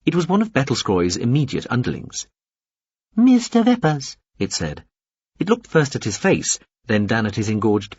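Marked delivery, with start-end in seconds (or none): 3.2-4.2 high, slow, formal little alien; a small alien addressing Veppers carefully. A narrator's reading. Little alien addresses Veppers, high and slow.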